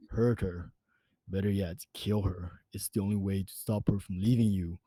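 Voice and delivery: low, soft, eerie voice in his head; an uncanny whisper from inside the mind; inner voice. A narrator's reading. Voice in his head urging him to let her in, low and eerie. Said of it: "the voice in my head spoke to me".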